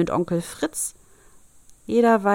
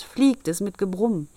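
usual speaking voice

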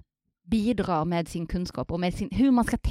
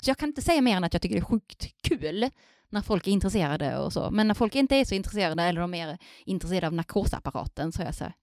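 ordinary talk

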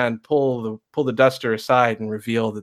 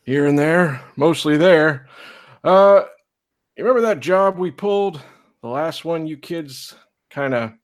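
put-on higher, steady old lawman; old marshal; 7 semitones higher than usual. Old marshal facing down the preacher, firm and high. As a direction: calmly overrule the preacher, slowing at the end.

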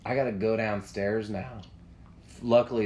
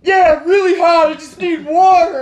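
retold frantic shout; 16 dB louder than usual. Desperate runner making excuses to girlfriend, yelling. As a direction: holler an excuse from far away.

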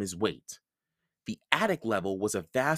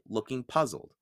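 plain reading voice

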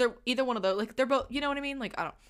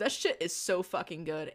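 plain reading voice

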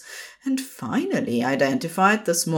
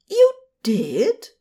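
high, louder gasped question; shocked gasp; 10 semitones higher, 5 dB louder than usual. A narrator's reading. Lifeguard stunned that Crowley showed off for him, gasping. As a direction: gasp it in disbelief. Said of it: "Aziraphale gasped".

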